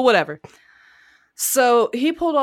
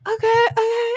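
much higher flustered husband voice; frantic husband; 3 dB louder than usual. Retold or imitated. Husband answering his panicking wife, flustered. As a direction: play the panicked husband agreeing.

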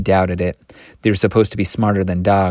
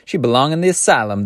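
higher, emphatic verdict; 5 semitones higher than usual. A narrator's reading. Old woman condemning the girl, emphatic. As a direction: declare it with emphasis. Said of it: "the old woman said emphatically".

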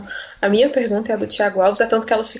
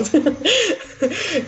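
giggle; 7 semitones higher than usual. Real, not acted. Happy about first press conference.

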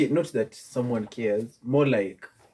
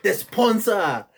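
excited; 8 semitones higher, 6 dB louder, much slower than usual. Real, not acted. Hailing the sponsor.

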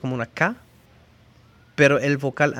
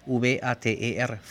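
usual speaking voice

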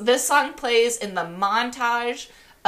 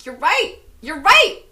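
realizing, excited; 8 semitones higher, 4 dB louder than usual. Acted. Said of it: "And she's like".

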